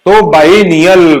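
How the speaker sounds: बायनियल said slowly, syllable by syllable; slow demo of biennial. Shown as a demonstration. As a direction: spell out बायनियल slowly for students. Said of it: "pronounce सही करो".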